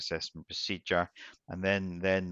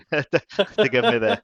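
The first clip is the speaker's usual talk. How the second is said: laughing; 13 semitones higher, 12 dB louder than usual. Real, not acted. Laughing while reassuring.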